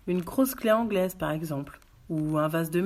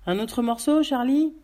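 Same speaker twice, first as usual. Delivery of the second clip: higher, louder offer through a mouthful; a detective at the kitchen table offers more meat, mouth full; 7 semitones higher, 7 dB louder than usual. A narrator's reading. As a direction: talk round a bite of lamb. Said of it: "de leurs grosses voix pâteuses".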